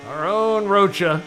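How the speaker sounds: ordinary delivery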